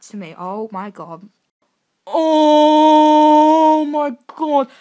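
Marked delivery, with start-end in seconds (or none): 2.1-4.7 very loud, very slow stretched shriek; drawn-out shriek. Real, not acted. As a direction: hold the scream out long. Fangirl overwhelmed by photo.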